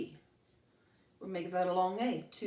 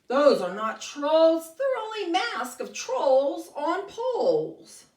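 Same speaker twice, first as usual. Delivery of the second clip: groaning goat; 6 semitones higher, 10 dB louder, slower than usual. Acted. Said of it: "Joey groans".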